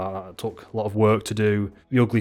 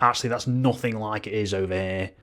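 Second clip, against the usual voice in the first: normal talking voice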